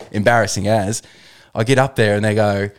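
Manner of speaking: ordinary talk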